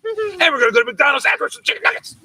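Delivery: high-pitched imitation of a demanding kid; bossy little daughter. Daughter giving dad pickup orders, mock bossy. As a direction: rattle off orders like a small queen.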